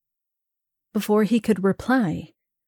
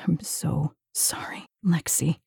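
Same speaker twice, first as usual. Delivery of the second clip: whispering; 4 semitones lower, 7 dB quieter than usual. Acted. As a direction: whisper it into her ear. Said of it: "he whispered in my ear".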